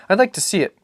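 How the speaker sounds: put-on keen young son; a child asking eagerly; eager boy. Boy's voice in read dialogue.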